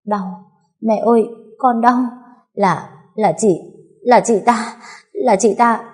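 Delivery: gasping, high-pitched accusation; broken words through pain. A narrator's reading. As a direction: struggle to get each word out in agony. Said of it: "chữ được chữ mất".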